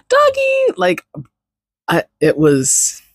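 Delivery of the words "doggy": "Doggy!" squealed very high after a gasp; gasp, then squeal "Doggy!" like a thrilled kid; excited kid squeal. Retold or imitated.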